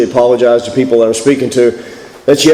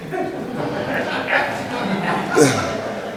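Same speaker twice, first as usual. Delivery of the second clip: breathy laugh; 4 semitones higher, 11 dB quieter than usual. Real, not acted. Laughs at his own joke.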